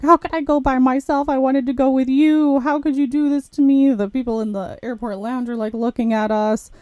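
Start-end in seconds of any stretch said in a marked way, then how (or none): 0.0-3.9 wounded protest pitched up high; mock tearful plea. Abandoned client begging her to come along, mock tearful plea. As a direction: imitate an upset man, higher-pitched and pleading. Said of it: "This guy was crying".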